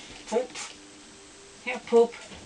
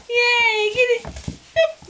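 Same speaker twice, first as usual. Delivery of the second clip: much higher squealing praise; excited puppy talk; 10 semitones higher, slower than usual. Real, not acted. Cheering puppy at play.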